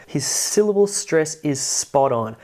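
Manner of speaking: ordinary talk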